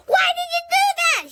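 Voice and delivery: very high loud angry holler. A narrator's reading. Lucille hollering at the girls for chasing the boy away. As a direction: holler at them furiously. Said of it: "she hollered".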